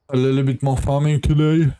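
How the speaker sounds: talking through a yawn; words stretched by a yawn. Real, not acted. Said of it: "I'm yawning, as you can hear".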